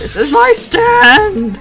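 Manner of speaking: slow, loud hammed-up reveal; dramatic shout. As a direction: roar it out slowly.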